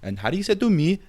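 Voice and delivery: hammed-up Australian accent, high and quick at the end; a cartoon Aussie bloke with a mock Australian accent. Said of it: "impersonate an Australian".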